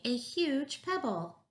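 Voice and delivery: high, stretched-out proud reveal; slow, high-pitched storybook triumph; triumphant announcement. A narrator's reading. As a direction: show off your amazing find. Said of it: "she shouted triumphantly".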